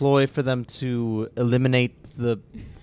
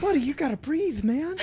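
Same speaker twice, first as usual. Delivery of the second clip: high voice; 11 semitones higher, 6 dB quieter than usual. Acted. Talking to squirrel Earl.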